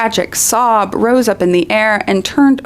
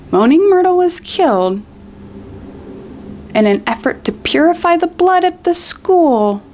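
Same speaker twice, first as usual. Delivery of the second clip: indignant emphasis; 9 semitones higher than usual. Real, not acted. Stressing the dark fact.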